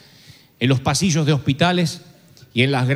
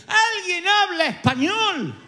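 desperate call. Retold or imitated. He calls out to the passengers, mock desperate. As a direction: call out to the cabin, half laughing. Calling out on the plane.

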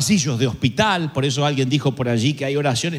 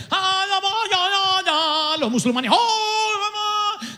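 mock panic; much slower than usual. Panicked passengers praying during lightning.

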